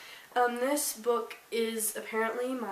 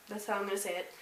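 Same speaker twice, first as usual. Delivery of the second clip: usual speaking voice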